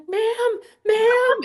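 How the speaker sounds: high, loud call across a parking lot. Retold or imitated. Photographer calling to a stranger across the lot, high and loud. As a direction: call out to get her attention.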